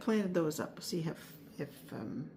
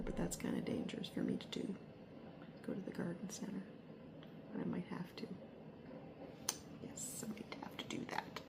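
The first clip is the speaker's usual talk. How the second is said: whispering; 14 dB quieter, faster than usual. Real, not acted. Joking about garden-center temptation.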